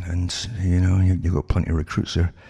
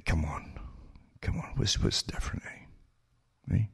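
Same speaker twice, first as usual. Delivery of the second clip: whispered, half to himself, come on; scoffing whisper; 3 semitones higher, 10 dB quieter than usual. Real, not acted. Whispering to viewers, scoffing at Wall Street reformers. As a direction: hush it like a disbelieving aside.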